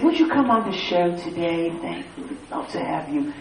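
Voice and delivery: mock-courteous British caller; pleasant caller. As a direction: imitate a pleasant invitation over the phone. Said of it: "they're so pleasant on the phone".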